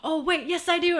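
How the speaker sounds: gasping, high and loud, oh wait yes; catching herself mid-sentence, delighted; sudden realization. Retold or imitated. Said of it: "And I went".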